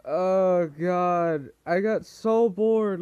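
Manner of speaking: loud drawn-out whine; whiny bored. Real, not acted.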